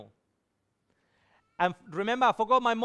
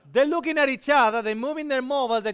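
laughing, louder and higher; 4 semitones higher, 5 dB louder, faster than usual. Real, not acted. Telling the congregation about the crowd, laughing. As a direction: laugh through the words.

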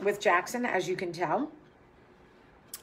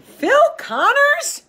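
high excited squeal of delight; 11 dB louder, slower than usual. Real, not acted. Excited at Groundhog Day film.

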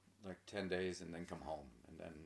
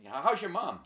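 imitating eager acquaintance; 11 semitones higher, 14 dB louder than usual. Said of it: "there'll be people going".

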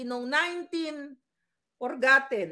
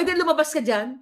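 a louder, sharp retort; 6 dB louder, faster than usual. Retold or imitated. Student telling off the short-changing cashier, loud retort. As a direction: call her out so everyone hears. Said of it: "Di ang ingay ko doon".

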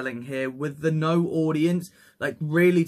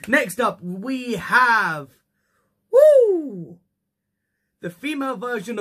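raised, drawn-out intro into a high whoo; hyped announcer; 6 semitones higher, 6 dB louder than usual. Acted. Host introducing the next match to viewers, hyped announcer. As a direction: build up to a loud, high wrestler's whoo.

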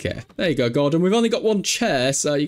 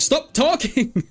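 high, slow mock telling-off; mock scolding; 9 semitones higher, much slower than usual. Real, not acted. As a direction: drag out a playful order to shut up. Scolding Sims on screen.

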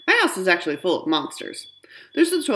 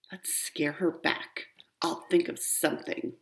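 scheming whisper; 8 dB quieter than usual. Acted. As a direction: plot it under your breath. Siblings plot secretly.

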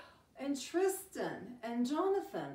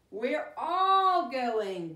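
Brother calling out; 9 dB louder, much slower than usual. Acted. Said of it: "Brother says".

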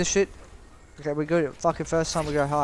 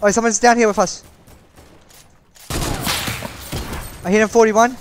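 excited callouts; 3 semitones higher, 4 dB louder than usual. Real, not acted.

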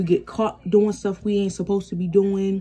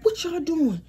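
higher-pitched imitation of a brother's scolding; mock fussing out; 8 semitones higher than usual. Brother fussing out the misbehaving pair, mock scolding. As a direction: fuss at them in a raised pitch, retelling it. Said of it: "My brother trying to fuss us out".

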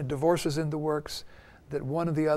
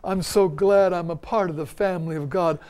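loud, gushing church greeting; mock sanctimonious; 6 dB louder than usual. Family arriving at church, suddenly sanctimonious greeting. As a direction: put on pious gladness for the congregation. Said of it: "Everything becomes sanctimonious".

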